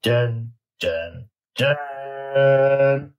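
mock dramatic "dun, dun, dun"; suspense sting. Mock drumroll before weight reveal.

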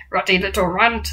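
ordinary delivery

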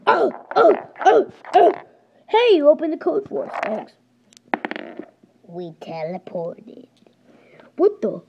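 usual speaking voice

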